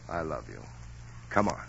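husband softening after scolding wife; tender confession. Acted. Husband drops to soft tender I love you. Gruff speech turns tender.

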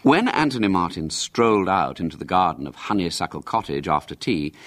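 plain reading voice

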